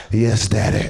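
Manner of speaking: a hint of a low, submissive roommate. Choked roommate answers his attacker, lightly voiced and low. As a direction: drop the voice a little for the roommate. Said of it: "I remember him going".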